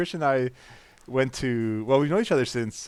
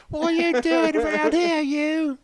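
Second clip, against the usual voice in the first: high, loud falsetto scolding; hammed-up English harridan in falsetto; Monty Python female character; 5 dB louder than usual. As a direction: shoo them off as an English sketch lady. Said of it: "traditional female character from Monty Python".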